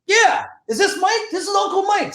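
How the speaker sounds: very high-pitched put-on caller voice; imitated Uncle Mike. Acted caller on the phone.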